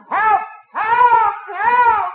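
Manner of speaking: high, loud comic yell for help; cry for help. Acted. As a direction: call out for rescue, high and loud. Exasperated customer calls for help.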